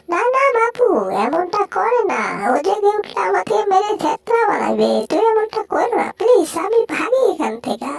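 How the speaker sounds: pleading little creature. Acted. Pleading character.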